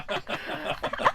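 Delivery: breathless high giggle; real wheezing laughter. Real, not acted. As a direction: crack up at the memory. Laughing at his nan's mistake.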